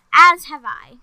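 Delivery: a loud, firm declaration; loud announcement. A narrator's reading. The queen tells Hudson she has decided, loud. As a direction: declare it loudly. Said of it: "Queen Samantha announced".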